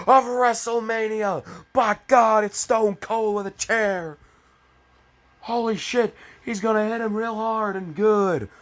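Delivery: high, loud hyped ringside commentator; wrestling announcer. Pretend. Reader turns narration into wrestling commentary. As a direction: yell it like a WrestleMania play-by-play.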